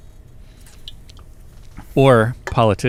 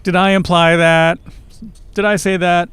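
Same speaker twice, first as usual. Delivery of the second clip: higher, louder, tightly wound counter; indignant acted questions, pitched up; tight retort; 7 semitones higher, 4 dB louder than usual. A narrator's reading. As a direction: counter a touch too tightly. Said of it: "a touch more tightly than he intended".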